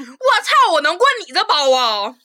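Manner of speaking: loud, slow, scornful comeback; mock angry yell. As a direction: snap back at her loudly. Retold quarrel, yelling back. (大声怒怼)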